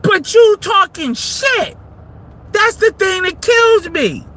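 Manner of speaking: normal talking voice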